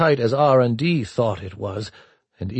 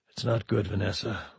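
quiet admission; 7 dB quieter than usual. A narrator's reading. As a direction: admit it quietly, subdued. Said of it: "he admitted".

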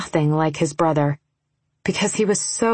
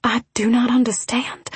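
high, confused whisper; whispered back; 6 semitones higher than usual. A narrator's reading. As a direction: whisper in bewilderment, pitched up. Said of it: "I whispered back".

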